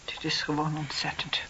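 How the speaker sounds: barely audible, breath only; the words knocked out of her; whispered in shock; stunned whisper. Acted. Shocked by the revelations.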